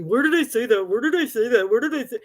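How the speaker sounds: lightly voiced, repeating it mockingly; mocking Amy. Mimicking a troll's denial to viewers, lightly mocking. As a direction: parrot her whiny denial. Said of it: "then Amy's gonna message me".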